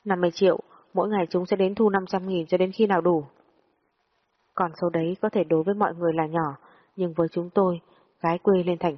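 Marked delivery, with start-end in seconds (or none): none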